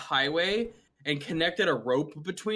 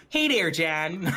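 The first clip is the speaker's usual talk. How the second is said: higher-pitched Wisconsin mom greeting; Wisconsin accent; 5 semitones higher, slower than usual. Retold or imitated. Imitating John's mom on speakerphone, in a higher Wisconsin accent. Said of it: "Wisconsin accents".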